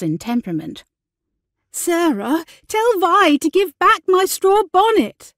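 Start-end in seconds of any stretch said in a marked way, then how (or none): 1.7-5.3 high, loud, indignant little-sister plea; high-pitched tattling demand; whining complaint. Acted. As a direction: complain shrilly to your big sister.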